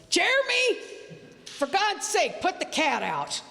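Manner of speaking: high-pitched yell easing down to an exasperated order; mother's yell. Retold or imitated. Dying mother orders son to put cat out, yelling. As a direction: cry the name high, then scold on a falling pitch. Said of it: "and she yells".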